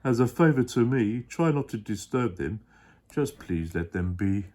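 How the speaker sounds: a big kind elephant voice, sure of himself; deep Horton voice, earnest. Acted. Dad as Horton explaining to his child.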